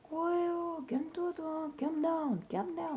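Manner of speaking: very high mock-protest voice; mocking squeal. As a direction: squeak back at a bossy character. Mock-calming a pushy game character. (voix suraiguë et moqueuse)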